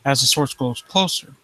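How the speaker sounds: low, hushed creepy-story reading; story narration. Acted. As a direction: draw the listener into the dread. Reads creepy story aloud.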